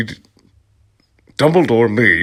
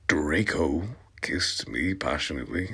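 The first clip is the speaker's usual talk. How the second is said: hushed; 3 semitones lower, 7 dB quieter than usual. Acted. Whispered line in comic fanfic reading.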